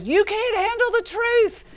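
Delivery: hammed-up Jack Nicholson yelling from the witness stand; Jack Nicholson impression. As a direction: ham up the courtroom blowup as Nicholson. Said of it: "Jack Nicholson in that rough voice goes".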